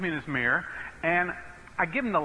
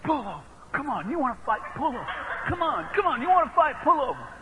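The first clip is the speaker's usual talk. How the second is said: high-pitched imitation of an old man itching to fight; mock challenge, climbing higher as it repeats; belligerent old driver; 8 semitones higher than usual. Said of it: "the guy does this to me".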